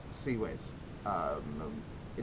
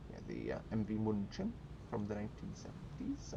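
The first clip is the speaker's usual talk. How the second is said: lightly put-on Swedish accent, softer; a half-hearted Swedish accent slipping in; 2 semitones lower, 7 dB quieter than usual. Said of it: "I'm doing a Swedish accent".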